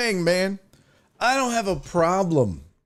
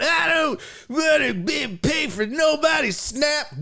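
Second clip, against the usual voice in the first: mocking shout; 10 semitones higher than usual. Mocking anti-snap hillbillies, a high shouted parody. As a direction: yell it like the fool he mocks. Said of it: "when you're out here talking about".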